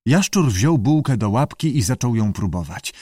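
usual speaking voice

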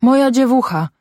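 high-pitched cry of grief; anguished cry. A narrator's reading. Mother held back from the sledge, crying out in anguish. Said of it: "krzyczała Zośka".

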